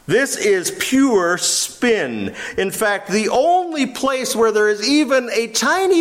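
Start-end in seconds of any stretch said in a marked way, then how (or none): 0.1-2.3 emphatic verdict. Real, not acted. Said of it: "emphatic verdict".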